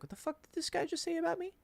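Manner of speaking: high, soft mock-incredulous squeak; retold disbelief. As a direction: stew aloud in a high, small voice. Retold stewing, knocked off his game.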